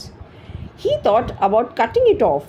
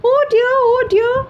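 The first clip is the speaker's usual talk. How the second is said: sobbed; 6 dB louder than usual. A narrator's reading. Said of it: "she sobbed".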